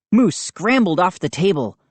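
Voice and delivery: plain reading voice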